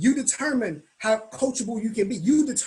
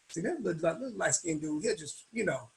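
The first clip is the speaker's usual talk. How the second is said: much softer, grumbling under the breath; muttered grumble; 4 semitones lower, 8 dB quieter than usual. Retold or imitated. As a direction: mutter it so he can't hear. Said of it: "I was like".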